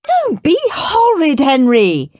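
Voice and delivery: high, loud, slow telling-off; shouting mum. Acted. Mum shouting at Henry from afar. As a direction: call out sharply from another room. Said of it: "shouted Mum".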